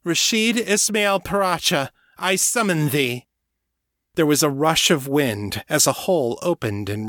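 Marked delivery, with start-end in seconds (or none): 0.0-3.3 loud, high ritual summons; loud calling out. Acted. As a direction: call out the summons loudly. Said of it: "calling out in a loud voice".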